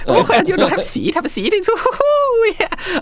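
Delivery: very high, gushing and excited emperor voice; giddy young emperor. Acted. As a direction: squeal each greeting like a flustered young ruler. Same emperor voice continues.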